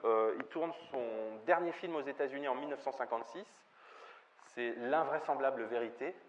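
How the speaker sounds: ordinary delivery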